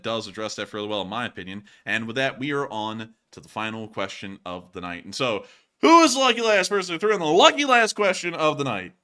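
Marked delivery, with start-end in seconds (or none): 5.8-8.9 loud, high game-show style call; hyped announcer. Pretend. Host announces the lucky last question, hyped announcer call. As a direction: belt out the big reveal, pitched up.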